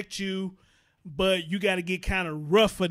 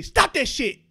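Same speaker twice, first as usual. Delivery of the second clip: sharp command; 7 semitones higher, 6 dB louder, slower than usual. Retold or imitated. Quotes a parent's sharp command.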